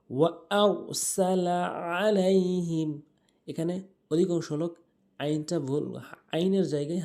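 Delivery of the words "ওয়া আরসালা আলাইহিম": Quran recitation. Shown as a demonstration. Recites the verse.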